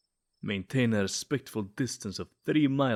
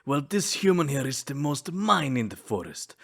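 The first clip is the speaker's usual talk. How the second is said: ordinary delivery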